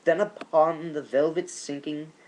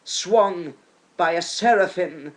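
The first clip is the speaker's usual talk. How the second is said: usual speaking voice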